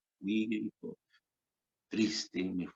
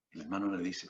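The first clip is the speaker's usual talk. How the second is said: ordinary talk, nothing marked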